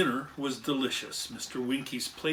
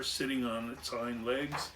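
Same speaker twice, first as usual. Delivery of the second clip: plain reading voice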